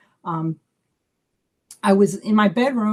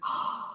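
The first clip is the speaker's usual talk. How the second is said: sharp gasp; 10 dB quieter than usual. Retold or imitated. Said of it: "I'm like".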